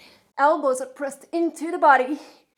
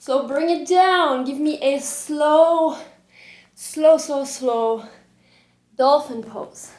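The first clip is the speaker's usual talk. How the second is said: slow motion; 2 semitones higher, 3 dB louder, slower than usual. Shown as a demonstration. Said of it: "We're gonna do it slow motion".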